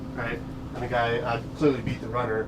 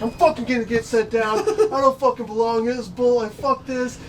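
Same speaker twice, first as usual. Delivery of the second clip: higher, louder, a touch laughing mock fury; fuming rant; 9 semitones higher, 4 dB louder than usual. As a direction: vent it like a steaming pitcher on the bench. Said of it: "he's like".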